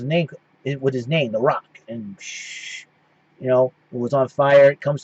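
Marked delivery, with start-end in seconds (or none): 2.2-2.8 breathy shh of a flamethrower; mock flamethrower whoosh; imitated flame hiss. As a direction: hiss it out like fire spraying.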